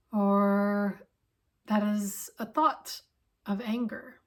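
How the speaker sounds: calm, unhurried instruction with long pauses; guided meditation. Acted. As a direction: gently settle listeners in, leaving space between phrases.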